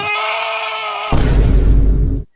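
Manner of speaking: high bleating goat-like scream. Acted. Singer on stage screaming like a goat, high bleat. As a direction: bleat like a goat instead of singing. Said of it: "screaming like a goat on stage".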